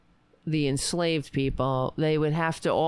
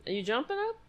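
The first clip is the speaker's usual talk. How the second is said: pet talk; 8 semitones higher, 5 dB quieter, much faster than usual. Real, not acted. Talking to her pet.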